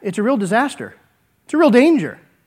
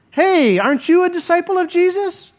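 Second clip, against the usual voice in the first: higher, lightly voiced servant girl; a girl calling Peter out, pitched up; servant girl accusing; 8 semitones higher than usual.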